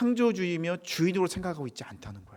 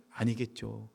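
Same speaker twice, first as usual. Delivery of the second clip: ordinary talk, nothing marked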